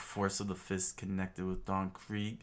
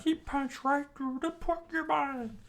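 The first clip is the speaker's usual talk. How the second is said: very high, loud astonished squeal; chef screaming; 5 dB louder than usual. A narrator's reading. Chef watching the punch, high loud squeal. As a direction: squeal it out in amazement. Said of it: "the chef screamed out".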